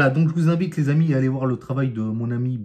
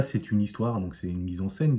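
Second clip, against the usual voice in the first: ordinary delivery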